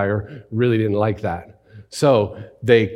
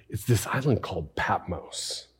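hushed whisper; 3 semitones lower, 9 dB quieter than usual. Acted. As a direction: whisper it's this island called Patmos as if far away. Preacher evoking remote island.

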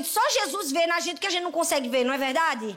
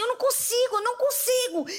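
put-on little girl whine; 7 semitones higher than usual. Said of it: "E ela fazia assim".

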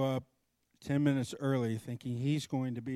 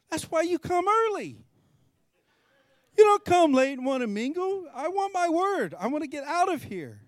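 exasperated rant, pitched way up; higher, louder rant; 13 semitones higher, 4 dB louder than usual. Retold or imitated. Frustrated rant at the friend who showed up late. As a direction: vent the frustration loudly and high.

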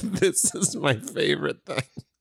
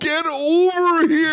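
high, loud slow villain yell; Scorpion battle cry; 11 semitones higher, 4 dB louder than usual. Pretend. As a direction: roar the catchphrase. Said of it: "Scorpion catchphrase".

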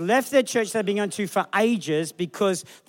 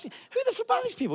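mock outraged squeal.

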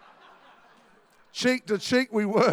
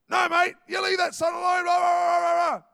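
gunman's shout; 6 semitones higher, 4 dB louder than usual. Retold or imitated. Gunman answering pastor.

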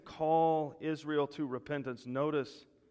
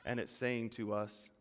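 ordinary talk, nothing marked

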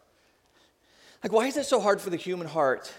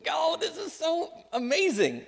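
a parent's hushed amazement; tender awe; 11 semitones higher than usual. Acted. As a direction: melt over the child. Parent awed by giggling child.

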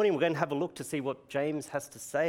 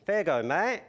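put-on broad Queensland Australian accent, raised; Queenslander accent; 6 semitones higher, 5 dB louder than usual. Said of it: "I'm gonna use my Queenslander accent".

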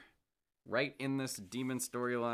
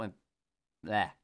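ordinary talk

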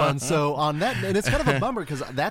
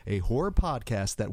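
ordinary talk

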